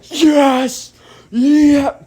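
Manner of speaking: loud, high mock celebration; gleeful high-pitched whoop; happy cheer. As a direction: imitate a kid thrilled to escape. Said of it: "David's pretty happy about that".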